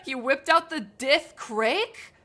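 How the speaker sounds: slow, loud disbelief rising on "out"; can't believe he said "Dith Crick"; incredulous. Real, not acted. Streamer reacts to the dwarf's slur, loud and incredulous.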